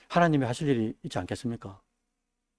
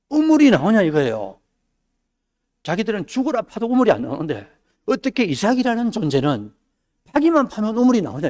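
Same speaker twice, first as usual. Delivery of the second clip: amazed king; 6 semitones higher, 3 dB louder than usual. Retold or imitated. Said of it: "왕이 보고 ... 뭘 느끼냐니까".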